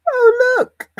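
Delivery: squeaky high voice. Pretend. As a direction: squeal it like a tiny cartoon critter. Squeaky voice exclaiming at screen.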